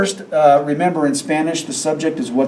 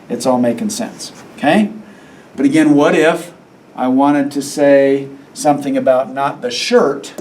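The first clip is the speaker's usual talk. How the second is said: normal talking voice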